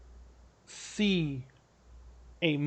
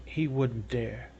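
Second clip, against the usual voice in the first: low, slow, softer realization; stopped short; 4 semitones lower, 6 dB quieter, slower than usual. Acted. Lord stops as suspicion dawns, slow and low. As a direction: say it slowly under your breath, pitched down. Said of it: "he kinda stops".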